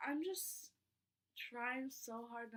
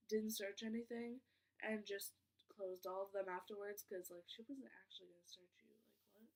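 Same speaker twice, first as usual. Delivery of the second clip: usual speaking voice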